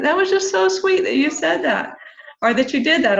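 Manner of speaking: high, eager gushing praise. Retold or imitated. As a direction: pitch it up, bubbling with delight. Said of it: "I'm like".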